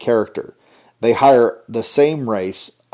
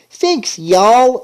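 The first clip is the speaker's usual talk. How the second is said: much higher mock chirpy thanks; mocking girly voice; 10 semitones higher, 3 dB louder than usual. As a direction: sing out a fake cheerful thanks. Mocking a cartoon character.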